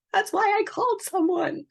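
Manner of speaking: squeezed, very high-pitched retold plea; high plaintive squeak. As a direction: say it in a tiny high mock-pleading voice. Desperate caller recalls asking for help.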